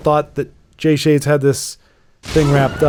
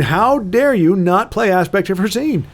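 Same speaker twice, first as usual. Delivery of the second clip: hammed-up imperious villain outrage; evil queen; 7 semitones higher than usual. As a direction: scold like a wronged tyrant queen. Scolds player in mock villain outrage.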